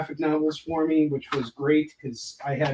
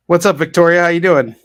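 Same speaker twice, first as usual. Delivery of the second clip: much louder, bright hail across the room; loud greeting; 3 semitones higher, 13 dB louder than usual. Real, not acted. Greeting Victoria as she comes in, loudly. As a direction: call out hello to Victoria.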